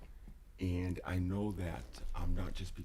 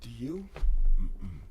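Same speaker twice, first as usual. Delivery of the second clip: normal talking voice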